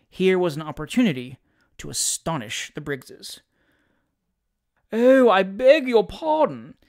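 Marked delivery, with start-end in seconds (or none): 4.9-6.8 drawn-out, high, airy apology; lofty and careless, stretching the words; careless air. A narrator's reading. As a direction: toss it off grandly, louder and slower. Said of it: "with a careless air".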